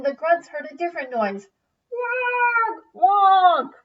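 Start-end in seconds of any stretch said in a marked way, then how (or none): 1.9-3.7 high, loud honking call; dinosaur honk. Acted. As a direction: honk like a big friendly dinosaur. The dinosaur's noise.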